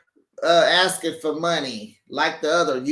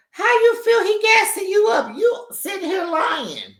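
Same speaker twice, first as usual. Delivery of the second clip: mock scolding; 13 semitones higher, 3 dB louder than usual. Scolding absent friend, high voice.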